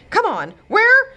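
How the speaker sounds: high, loud, slowed panic; urgent frightened question; panicky. Acted. Godmother panicking at beach.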